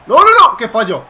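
high, loud cry of protest; dismayed shout. Real, not acted. Gamer shouting as his move fails. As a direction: yell no in panic.

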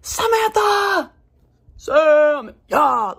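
loud, high, drawn-out cheer of her name; excited calling out. Real, not acted. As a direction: call her name out like she just walked in. Friend cheers her name on camera.

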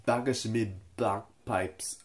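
a clumsy, slow attempt at a Scottish accent; bad Scottish accent. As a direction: ham up a Scottish accent badly, on purpose. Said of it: "I'm not good at this right now".

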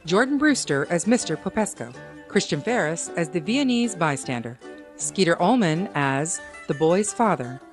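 ordinary talk, nothing marked

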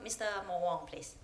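usual speaking voice

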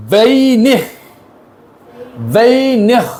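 "ওয়েইনিখ" in two drawn syllables ending in a kh rasp; careful Dutch pronunciation. Shown as a demonstration. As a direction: model "ওয়েইনিখ" with a long vei and a throaty kh. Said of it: "এইটার উচ্চারণ একটু দেখেন".

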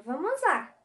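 ordinary delivery

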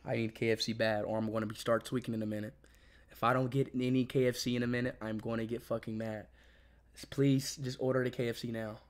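low, very soft insistence; quiet pleading. Real, not acted. Hungry streamer needs KFC ordered now, quiet pleading. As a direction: press them urgently but keep it down. Begging someone to order food.

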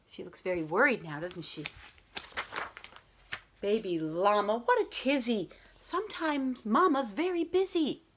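lilting rhyming read-aloud voice; bedtime story reading. A narrator's reading. Teacher reading a bedtime story to her class, gentle and lilting. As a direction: read it like a picture book at bedtime.

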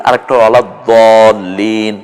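ওলা দলিন said slowly with the heavy দ; slow heavy দ demo; slow contrast take. Shown as a demonstration. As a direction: draw it out to show the দ. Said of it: "ওলা দাল্লিন".

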